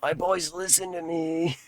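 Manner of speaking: croaking, strained throat voice; raspy dying voice. Acted. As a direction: rasp it out like a dying villain. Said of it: "Sounds like throat cancer".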